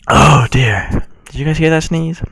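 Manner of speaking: loud startled oh dear; surprised exclamation. Real, not acted. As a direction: react loudly to your own sneeze. Exclaiming after sneeze.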